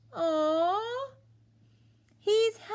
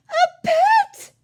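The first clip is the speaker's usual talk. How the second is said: much higher, loud squeal of joy; excited cry; 10 dB louder than usual. Acted. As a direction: burst out thrilled.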